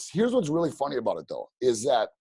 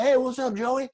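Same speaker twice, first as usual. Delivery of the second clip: high, bright-eyed "hey Joey" at a higher pitch; imitating people reading his name tag, pitched up; mock friendly greeting; 11 semitones higher than usual. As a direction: mimic strangers greeting you eagerly.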